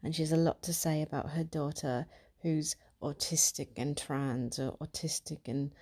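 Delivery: put-on chatty British accent as a party trick. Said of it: "I'm not gonna do a fucking British accent".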